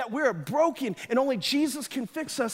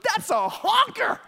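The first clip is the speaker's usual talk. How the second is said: a higher, awed exclamation, slow at the end; boy stunned by the pastor's nose, awed and higher; amazed kid; 6 semitones higher, slower than usual. Retold or imitated. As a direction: gape at the big nose. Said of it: "my face was like".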